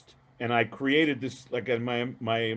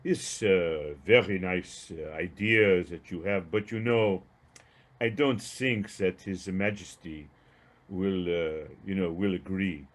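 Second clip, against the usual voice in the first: put-on lower, halting French-accented English; imitated French accent; 4 semitones lower, slower than usual. Caller doubting His Majesty will agree, in an imitated French accent. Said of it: "French accent, which I'll only try to imitate".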